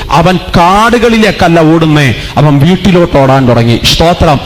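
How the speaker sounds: full-force revival sermon delivery; impassioned, declamatory preacher; forceful preaching. Real, not acted. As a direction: drive each phrase home to the congregation, stretching key words.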